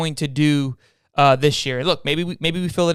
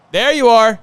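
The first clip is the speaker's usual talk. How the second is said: excited greeting; 7 semitones higher, 8 dB louder, slower than usual. Real, not acted. Greeting co-host joining the stream.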